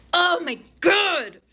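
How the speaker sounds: high, loud, slow exclamation; excited shout. Real, not acted. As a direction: cry out in delight. Delighted outburst.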